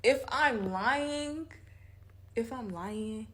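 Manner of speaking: high, slow and pleading; slow insistence. Real, not acted. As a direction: stretch it out like swearing it is true. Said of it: "insisting, slow and strained".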